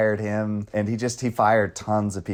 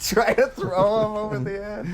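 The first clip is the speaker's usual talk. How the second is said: ordinary talk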